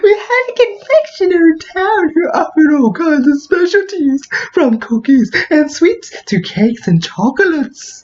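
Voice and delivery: very high put-on character voice; awfully high voice. Player reading the confectioner backstory, awfully high voice. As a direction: read it way up high, slowing toward the end. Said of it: "Just give it an awfully high voice".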